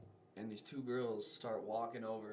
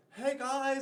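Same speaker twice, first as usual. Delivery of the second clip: much higher, louder mock call-out; imitated call; 12 semitones higher, 5 dB louder than usual. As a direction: hail the group like an intruding buddy. Imitating buddy calling after them.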